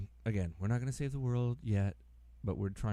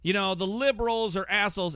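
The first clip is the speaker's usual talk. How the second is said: much higher and louder, indignant pundit; whiny high protest; 10 semitones higher, 7 dB louder than usual. Pretend. Improv skit, playing Hannity protesting to his therapist, high and loud. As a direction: whine it like a cornered pundit.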